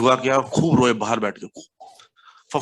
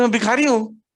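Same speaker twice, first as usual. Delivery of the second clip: proud retort; 9 semitones higher, 4 dB louder than usual. Retold or imitated. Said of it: "बोलता".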